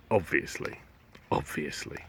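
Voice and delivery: soft then whispered; fading aside. Acted. Slime muttering the obvious. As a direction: drop to a whisper.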